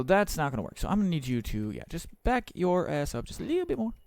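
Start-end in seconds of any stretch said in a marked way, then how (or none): none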